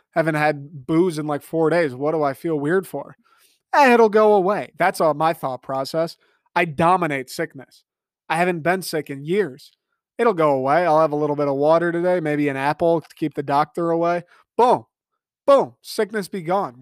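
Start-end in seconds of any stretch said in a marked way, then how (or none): 3.7-4.7 dismissive. Retold or imitated. Dismissing the illness. 14.6-16.8 cocky. Retold or imitated. Cocky self-talk about beating sickness.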